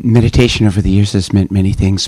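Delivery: ordinary delivery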